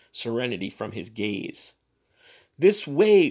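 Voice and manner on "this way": loud high call; rally the women; cried out. Acted. Bellows to the ladies, loud rallying cry. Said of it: "cried Missus Bellows".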